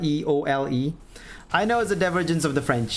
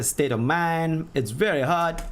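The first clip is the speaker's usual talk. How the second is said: normal talking voice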